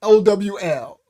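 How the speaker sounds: spelled out loud and emphatic. Shown as a demonstration. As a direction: spell it out clearly and loudly. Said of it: "y'all won't know I'm saying owl".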